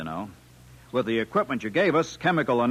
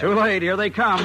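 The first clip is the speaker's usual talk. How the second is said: excited; 9 semitones higher, 4 dB louder than usual. Acted. Engineer springs trap on alien.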